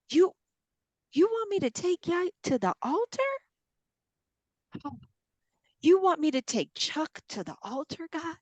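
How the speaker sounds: high, rising disbelief; incredulous questioning. Acted. Believer asking God about the altar, incredulous questioning. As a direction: question God as if it cannot be true. Acted disbelief at God's demand.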